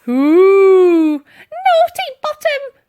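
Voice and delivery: put-on an old lady scolding her own bottom; loud high hammed-up scold; calling out. A narrator's reading. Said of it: "called out Dotty".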